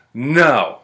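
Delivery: whiny mock bellow; stubborn drunk refusal. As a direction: imitate a drunk friend refusing to budge. Drunk friend's stubborn refusal, retold.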